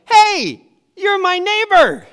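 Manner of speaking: full-volume high shout; yelling across. Retold or imitated. Driver shouting back at his yelling neighbor, loud high holler. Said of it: "this is what I said to him as he's yelling at me".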